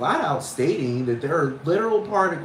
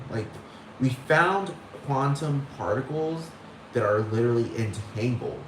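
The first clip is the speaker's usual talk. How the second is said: normal talking voice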